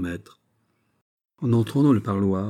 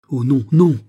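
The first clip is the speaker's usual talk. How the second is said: loud, higher-pitched outcry; crying out; 5 semitones higher, 9 dB louder than usual. A narrator's reading. King refusing his beloved's sacrifice, crying out. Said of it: "s'écria le roi".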